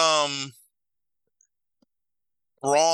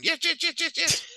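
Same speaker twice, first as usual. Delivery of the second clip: very high frantic yelping yes; Brutus Beefcake yelping. Retold or imitated. Wrestler bouncing around the ring, squealing high. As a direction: squeal it like a hyped wrestler running. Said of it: "run back and forth going".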